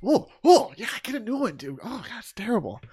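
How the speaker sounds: high, loud disgusted clerk voice. Retold or imitated. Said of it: "the guy's all like".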